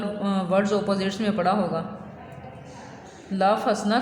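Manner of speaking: normal talking voice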